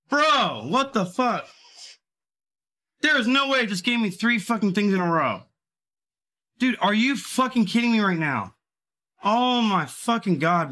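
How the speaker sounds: can't believe this losing streak, outraged; furious disbelief. Real, not acted. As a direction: rage at the machine in disbelief.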